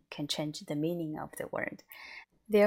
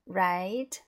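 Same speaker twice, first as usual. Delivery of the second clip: "right" gliding up like a question; questioning rise. Shown as a demonstration. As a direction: stretch "right" and lift it at the end. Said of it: "like a question tone".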